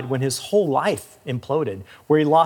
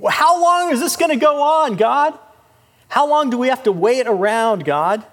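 high, loud exasperated plea; anguished complaint; 8 semitones higher, 4 dB louder than usual. Acted. Preacher voicing Habakkuk complaining to God, high and loud. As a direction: demand an answer from God, pained.

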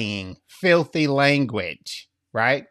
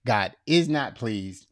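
ordinary delivery

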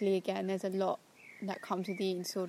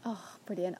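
ordinary talk, nothing marked

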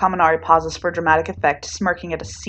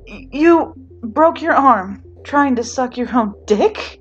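shocked disbelief; 7 semitones higher, 4 dB louder, slower than usual. Acted. Kirishima shocked and laughing.

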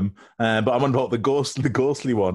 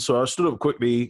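ordinary talk, nothing marked

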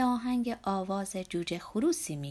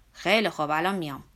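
giving in; 4 semitones lower, 7 dB louder than usual. Acted. Giving in to Zorba.

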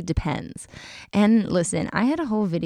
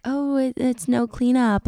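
higher, airy mock of other people; mimicking other people; 7 semitones higher than usual. Girl quoting people who say it's no cleanup, high mimicking voice. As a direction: pitch it up to parody their opinion.

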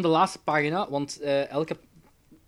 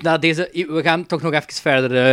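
ordinary delivery

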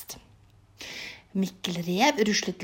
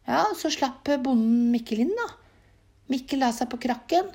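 ordinary delivery, nothing marked

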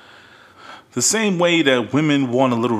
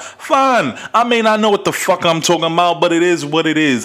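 loud, higher, fast comeback; heated loud retort; 6 semitones higher, 4 dB louder than usual. Real, not acted. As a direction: fire back loud and quick. Heated reply to critic.

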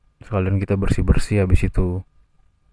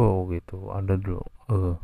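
usual speaking voice

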